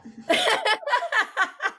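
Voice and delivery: sudden high-pitched laugh. Real, not acted. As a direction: burst out in a squeaky laugh. Laughing at the burp.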